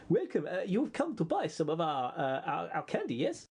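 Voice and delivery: shopkeeper in a light put-on Lapland accent. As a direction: sell the candy in a mock Lapland lilt. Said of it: "Dead-on Lapland accent".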